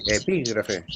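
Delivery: "Epígrafe" con la í tónica; acento en la I. Shown as a demonstration. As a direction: modela "Epígrafe" cargando la voz en la í. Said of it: "el acento en la I". ("Epígrafe" como esdrújula, golpe en la í)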